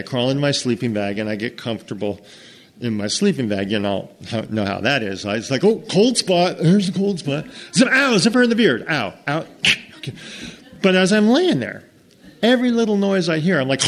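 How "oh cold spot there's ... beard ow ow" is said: mock discomfort. Acting himself in sleeping bag.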